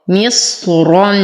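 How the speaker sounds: full i, held heavy ص, then nasal ending in مصرا; heavy, held Sad. Shown as a demonstration. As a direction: chant مصرا slowly, lingering on a heavy ص. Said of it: "أصبر على الصاد". (ترتيل مصرا مع الصبر على الصاد وتفخيمها)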